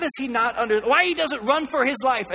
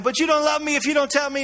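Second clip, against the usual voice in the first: higher-pitched mock pleading; lightly acted wheedling, pitched up; 6 semitones higher than usual. Delilah pressing Samson for his secret, mock pleading.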